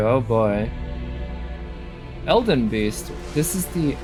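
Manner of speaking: ordinary talk, nothing marked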